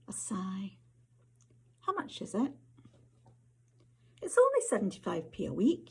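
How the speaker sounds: measured, intimate Scottish storytelling read; story narration. A narrator's reading. Author reading to an audience, story narration. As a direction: author reading her flash fiction aloud, unhurried and close. Reads second story aloud.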